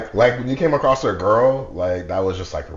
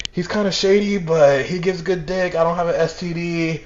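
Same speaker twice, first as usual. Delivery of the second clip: put-on higher-pitched voice of a girl reasoning; imitating a girl; 6 semitones higher than usual. Girl talking herself into seeing him, imitated high. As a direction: pitch it up as her weighing him up. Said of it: "She's like".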